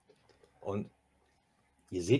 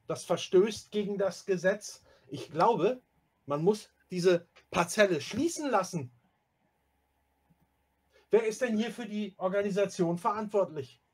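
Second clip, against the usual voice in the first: high-pitched outraged complaint; indignant, raised-pitch protest; officious indignation; 6 semitones higher than usual. Acted. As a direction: demand answers like an offended stickler. Erasmus demands who is responsible.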